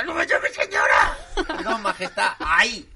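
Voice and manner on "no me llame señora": 1 tag: offended queen. Pretend. Reina corrige al mayordomo.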